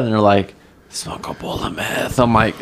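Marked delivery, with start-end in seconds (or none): 0.9-2.2 soft, slow, shady whisper; whispered offer. Retold or imitated. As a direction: hush it like a sketchy tweaker. Tweakers offering meth at night.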